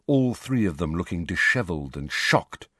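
ordinary delivery, nothing marked

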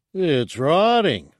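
high, loud, drawn-out complaint; shouted. A narrator's reading. Guard objects to the rotting corpse, shouting. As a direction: shout it slowly in disgust. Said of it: "Ragwa shouted".

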